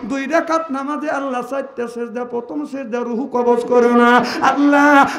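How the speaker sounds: sung, surging sermon chant; preaching at full force. Acted. Preacher telling Fatema's death to the gathering, chanting. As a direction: chant the story to the crowd.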